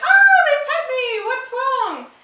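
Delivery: very high, loud, slow squeaky dog voice; voicing a confused dog. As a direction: squeal it out like a puzzled pup. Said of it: "And they're like".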